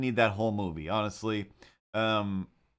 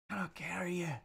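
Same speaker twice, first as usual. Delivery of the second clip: breathy whisper in a high hobbit voice; whispered Samwise; 7 semitones higher, 9 dB quieter, faster than usual. Retold or imitated. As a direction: let the line sink into a whisper. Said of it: "that's not a good impression".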